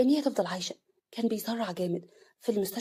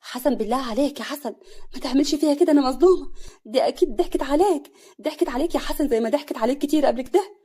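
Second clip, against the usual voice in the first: higher, loud desperate begging; pleading; 5 semitones higher, 8 dB louder than usual. Acted. Nawal begging Hassan, loud and desperate. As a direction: beg him to save her. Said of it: "نوال بتوسل".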